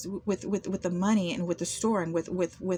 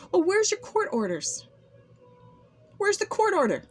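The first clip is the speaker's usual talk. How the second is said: indignant demand; 4 semitones higher than usual. Retold or imitated. Indignant challenge to accusers.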